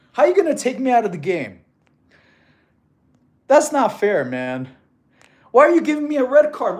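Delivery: high, loud complaint at an unfair call; indignant mock protest. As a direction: plead your case like a wronged player. Mock protest over a red card.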